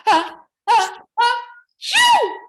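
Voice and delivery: rising uh uh uh into a very high loud achoo; building sneeze. Shown as a demonstration. Pine cone catches a cold, building to a high loud sneeze. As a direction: wind up and burst into a big sneeze. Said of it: "Can you guys sneeze like that".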